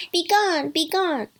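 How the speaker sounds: put-on high, loud kids' cry; defiant dismissal. A narrator's reading. Kids banish the hairy-footed wolf, defiant. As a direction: shoo the wolf away sharply.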